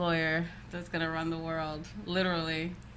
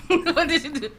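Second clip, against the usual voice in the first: high, loud Haitian scolding with a laugh; tough Haitian parent; 7 semitones higher, 9 dB louder than usual. Retold or imitated. Haitian parent interrogates the child, loud mock-stern accent, amused. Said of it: "being raised with tough Haitians".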